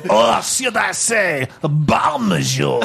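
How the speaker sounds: theatrical French bomb, called out; French accent. Acted. Bomb introducing itself to players, theatrical French accent. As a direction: draw out the greeting in mock French. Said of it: "It's got eyes and a French accent".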